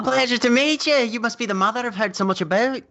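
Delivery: high-pitched, over-intense artist act; artistically disreputable hipster. Acted. Tig meets Thistle's mother, playing an over-intense hipster, high. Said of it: "over-intensive arti-, artistically disreputable".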